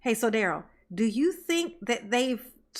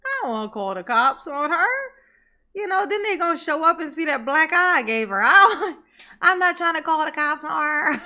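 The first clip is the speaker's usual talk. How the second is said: hammed-up higher-pitched whine, breaking into laughter; mock pleading; 4 semitones higher, 5 dB louder than usual. Host mocking the suspect's excuse over calling cops, mock pleading. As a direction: mimic his plea mockingly, laughing through it.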